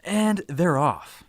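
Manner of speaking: high, drawn-out starting call; slow, high "they're off" announcement; race announcer. Pretend. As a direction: launch it like a race commentator. Calls giveaway start like a race.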